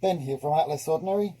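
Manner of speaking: talking through a face mask; muffled, mask over the mouth. Real, not acted. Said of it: "If I sound a little bit muffled, I've got my mask on".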